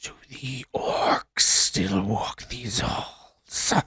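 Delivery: slow, hollow whisper from beyond the grave; ghostly whisper. Acted. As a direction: breathe it out like a trapped spirit. GM voices the spirit.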